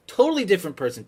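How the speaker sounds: ordinary talk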